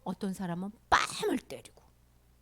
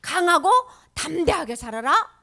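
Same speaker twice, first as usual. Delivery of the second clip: loud, emphatic charge; bold exhortation; 4 semitones higher, 8 dB louder than usual. Acted. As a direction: urge them on with force. Voices Jesus urging boldness.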